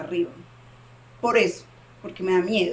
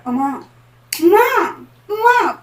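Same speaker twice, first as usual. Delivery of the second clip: desperate shout building much higher and louder; calling mom with all her strength; 9 semitones higher, 10 dB louder, slower than usual. Retold or imitated. A frightened daughter screaming for her mom from sleep paralysis. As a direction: cry out for mom in a nightmare. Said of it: "yo lo hacía con todas las fuerzas".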